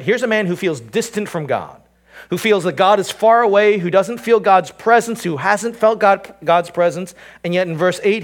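impassioned sermon build; preaching at full force. Acted. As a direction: drive the point home from the pulpit.

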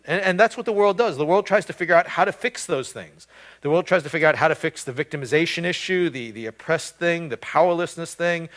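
rising pulpit intensity; full-force preaching. Acted. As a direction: drive it home to the congregation.

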